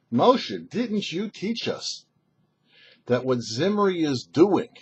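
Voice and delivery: usual speaking voice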